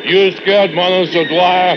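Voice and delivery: high-pitched challenging yell; shouted taunt. Acted. Worker taunting his foreman over the noise, shouted challenge. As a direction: throw the dare back across the din.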